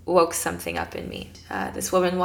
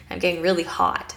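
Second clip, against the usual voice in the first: usual speaking voice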